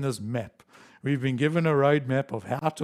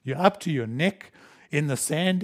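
ordinary delivery

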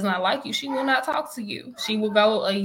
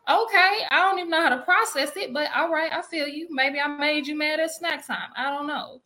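mock bewildered; 6 semitones higher than usual. Acting her own baffled reply.

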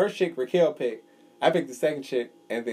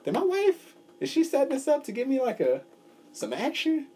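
much higher, worried voice of the friend; mock nervous; 12 semitones higher than usual. Retelling a friend's couples massage, mock nervous and high. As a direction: ham up the nervous husband wondering if it's a setup. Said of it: "I got kinda nervous".

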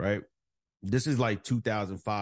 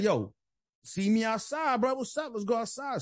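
heated challenge; 10 semitones higher than usual. Retold or imitated. Challenging the guy to fight outside.

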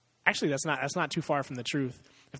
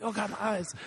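high, hammed-up cry of blinded eyes; mock pained cry; 7 semitones higher than usual. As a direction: ham up being blinded by light.

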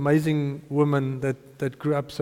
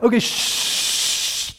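shushing; 6 semitones higher, much slower than usual. Retold or imitated. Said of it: "and then it's like".

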